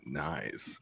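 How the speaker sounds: low, slow seductive murmur; deep sexy voice. Pretend. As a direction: purr it out low and sultry. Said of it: "I'm gonna use my deep and sexy voice".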